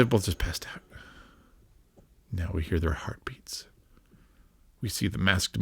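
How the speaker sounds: soft breathy whisper, hushed. Real, not acted. Narrating the eerie ending to a friend, whispered. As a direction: whisper through the suspense. Tense ending of scary cartoon.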